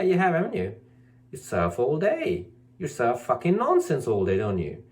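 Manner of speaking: usual speaking voice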